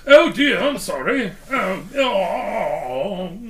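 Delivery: high, loud British moaning; polite British dying. Acted. Co-host imagines a British man dying politely, mock British wailing. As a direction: expire apologetically in a hammed-up British voice. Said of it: "well, he's British".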